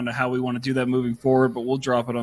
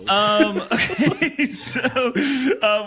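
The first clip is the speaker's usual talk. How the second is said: laughing; 10 semitones higher, much slower than usual. Real, not acted. Laughing at friend's speech.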